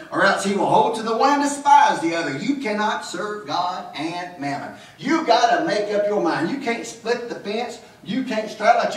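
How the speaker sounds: fervent sermon, pressing the congregation; preaching. Acted. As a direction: preach it out with full conviction.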